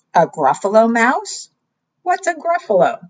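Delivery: puzzled owl voice. Acted. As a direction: squeak out the question as the owl. Owl asks in disbelief.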